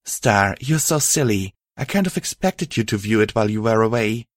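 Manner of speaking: ordinary delivery, nothing marked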